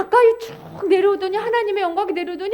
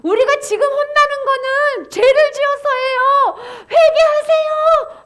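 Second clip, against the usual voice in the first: high, slow, loud proclamation; crying out; 10 semitones higher, 6 dB louder, slower than usual. Shown as a demonstration. Watchman telling people to repent, high stretched shout. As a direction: shout with all your strength. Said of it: "하고 외치는".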